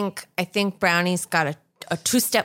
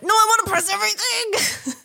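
high, whiny cat protest, much higher; faster than usual. Retold or imitated. Brownie the cat refusing to stop, high whiny protest. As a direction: squeal it like a stubborn cat. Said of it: "she was like".

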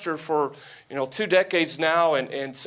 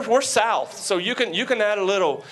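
ordinary delivery

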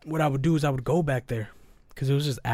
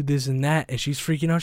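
usual speaking voice

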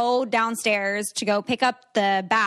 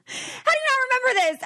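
disbelief; faster than usual. Real, not acted. Friend forgot the socks story.

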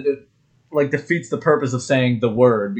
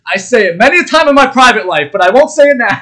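loud, high mock protest; Norm Macdonald; 9 semitones higher, 9 dB louder than usual. Podcaster acting out the guest's audacious refusal, loud and high. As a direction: refuse loudly, pitched way up. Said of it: "has the audacity to go".

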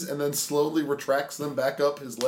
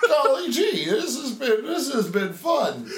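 fake wholesome; 8 semitones higher, slower than usual. Mocking kid's wholesome praise.

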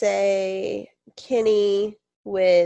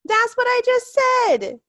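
exasperated; 7 dB louder, much slower than usual. Real, not acted. Repeated exasperated complaint.

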